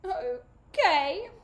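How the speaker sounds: teary okay. A narrator's reading. Sister agrees, still teary.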